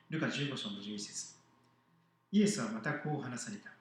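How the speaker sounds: measured Bible passage reading; scripture reading. Acted. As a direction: read the verses out clearly to the congregation. Reads scripture aloud.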